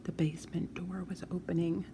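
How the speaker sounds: hushed, voiceless whisper. A narrator's reading. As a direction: breathe it out in a tense whisper. Narrator hushes at tense moment.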